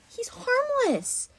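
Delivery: very high, slow protest; pained insistence. Acted. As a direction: stretch it into a pleading squeak. Protesting harm to a harmless creature.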